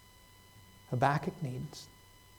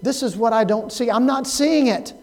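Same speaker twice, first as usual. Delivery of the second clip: crying out to God; 6 semitones higher, 4 dB louder than usual. Retold or imitated. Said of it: "we cry out".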